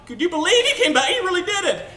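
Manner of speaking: high, loud excited disbelief; hammed-up incredulous chatter; acted amazement. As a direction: gush it in a high, astonished voice.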